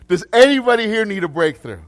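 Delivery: high, loud call to the room; loud repeated appeal. Real, not acted. Preacher re-asks an unresponsive congregation about breakthrough, loud and high. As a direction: ask it big, as if the mic were off. Repeats louder after mic-check joke.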